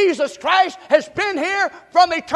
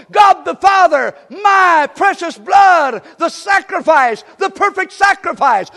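louder, higher, shouted praise preaching; preaching at full force; 5 semitones higher, 6 dB louder than usual. Real, not acted. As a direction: let the joy break out and preach it loud. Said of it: "I'm about to get a little happy right here".

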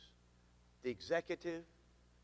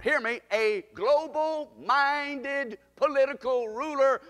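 higher, drawn-out prophetic proclamation; emphatic pulpit warning; preaching at full force; 5 semitones higher, 5 dB louder than usual. Real, not acted. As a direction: warn the church, weighing each word. Preaches prophecy of coming ruler.

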